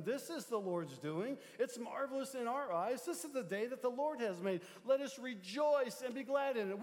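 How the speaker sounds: rising preached psalm reading; scripture reading. Acted. Pastor reading the psalm to the congregation, rising fervour. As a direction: proclaim the verse to the church.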